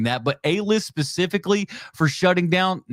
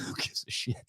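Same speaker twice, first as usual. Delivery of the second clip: much softer, whispered scoff; whispered aside; 15 dB quieter than usual. Real, not acted. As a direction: toss it off under the breath. Dismissive aside to viewers.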